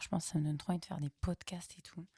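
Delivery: quick hushed whisper, half to herself; quick confiding whisper; whispered aside. Real, not acted. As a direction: drop to a fast whisper as a private aside. Aside about the mic, whispered.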